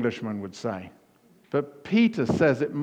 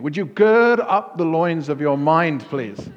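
louder, higher mock plea to a sleepy colleague; mock request; 5 semitones higher, 7 dB louder, slower than usual. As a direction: loudly say "Would you gird up the loins of your mind" to wake someone. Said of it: "Try that with a colleague".

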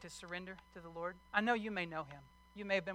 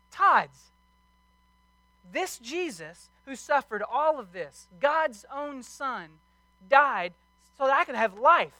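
higher, louder preaching that keeps building; fiery pulpit preaching, voice raised high; full-force preaching; 5 semitones higher, 8 dB louder than usual. Acted. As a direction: preach it hard to the congregation.

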